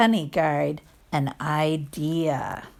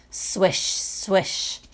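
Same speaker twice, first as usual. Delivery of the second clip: soft unvoiced swishing; whispered swish; 4 dB quieter than usual. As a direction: breathe it like grass in the wind. Grass sound effect.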